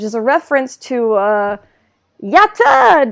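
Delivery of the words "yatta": long, loud, high exclamation; mock cheer. Names the reference song.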